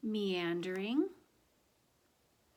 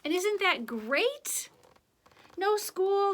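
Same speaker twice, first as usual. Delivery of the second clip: much higher, drawn-out gleeful exclaim; delighted; 10 semitones higher, 8 dB louder, slower than usual. Acted. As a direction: sell the joy of a free day. Reader delights in empty day.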